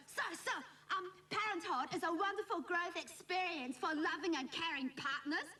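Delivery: high-pitched mock teacher's pet, reciting; eager classmate. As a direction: imitate a keen pupil answering the teacher. Keen classmate answering teacher, mimicked.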